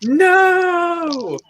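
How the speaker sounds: high, slow mocking wail into a shout; hammed-up outraged yell; mock outrage. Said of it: "I coulda said something mean like".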